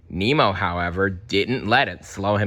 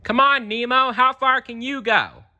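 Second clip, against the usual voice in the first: put-on high, louder taunting kid; Tad challenging; 4 dB louder than usual. Tad daring Nemo to swim out. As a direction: dare him like a cocky kid. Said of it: "Tad challenged".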